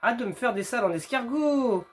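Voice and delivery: loud frustrated outburst at the game; exasperated yell. Real, not acted. Gamer yelling at game.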